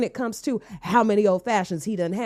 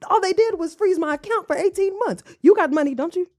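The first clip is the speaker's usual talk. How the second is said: high, loud cocky brush-off; hammed-up man waving off her worry; dismissive boyfriend; 7 semitones higher, 4 dB louder than usual. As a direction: play him brazenly unbothered.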